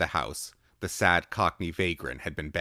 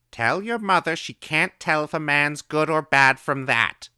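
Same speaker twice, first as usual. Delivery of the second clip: put-on high, loud old woman calling; old woman's voice; 11 semitones higher, 10 dB louder than usual. A narrator's reading. Old woman calling answers to the boy through the door.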